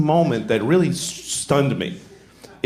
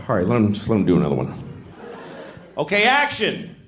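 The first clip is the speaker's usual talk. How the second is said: director calling action; 7 semitones lower than usual. Acted.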